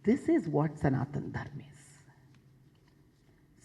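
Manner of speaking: hushed, slow whisper; a reverent whispered aside. Real, not acted. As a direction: drop to a slow breathy hush to land the point. Solemn point in a speech.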